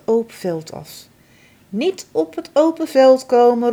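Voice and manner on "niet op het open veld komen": mother calling a warning, louder. Acted. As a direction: call out in warning to a child. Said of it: "roept mama".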